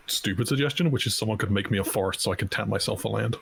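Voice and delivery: usual speaking voice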